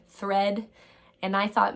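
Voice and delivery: ordinary talk, nothing marked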